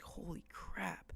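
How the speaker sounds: hushed, stretched-out retold amazement; stunned. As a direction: let it out slowly, under your breath. Stunned reaction to the drunk crowd.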